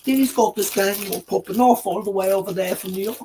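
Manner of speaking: high, loud mock British accent; British accent imitation. Friend mocking the host's British accent, high and loud. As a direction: ham up a posh British narrator voice. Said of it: "in his British accent".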